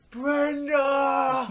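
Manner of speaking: full-volume, very high drawn-out bellow; imitated scream. Drunk man comes down the stream, screaming. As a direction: holler it down the river.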